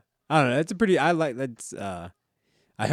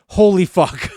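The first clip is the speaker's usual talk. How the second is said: imitated shocked outburst, much higher and louder; shocked exclamation; 8 semitones higher, 12 dB louder, slower than usual. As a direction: blurt it out like a stunned doctor. Doctor's shocked reaction.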